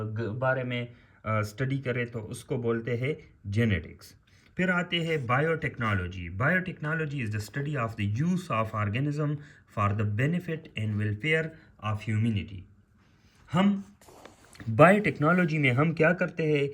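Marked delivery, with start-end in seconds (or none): 6.3-12.6 definitions read out slowly for note-taking; teacher dictating the definition word by word; slow dictation. Shown as a demonstration. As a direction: spell out each phrase slowly for students. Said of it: "dictating definition".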